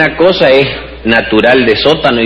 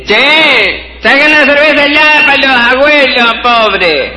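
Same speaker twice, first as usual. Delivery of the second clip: calling out; 14 semitones higher than usual. Retold or imitated. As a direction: shout across the party for beer. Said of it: "hay uno que dice".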